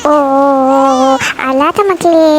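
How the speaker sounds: long, low cooing; soothing a baby. Acted. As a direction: slowly hush a crying baby. Mother soothes her crying baby.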